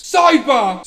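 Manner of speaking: host yelling a segment title; loud announcement. Real, not acted. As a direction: burst in like a game-show host.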